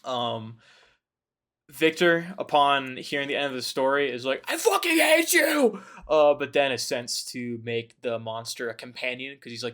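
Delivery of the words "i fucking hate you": high, loud cry of hatred through a laugh; hammed-up furious yell; furious outburst. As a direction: scream it at him, half laughing. Victor's outburst at the monster.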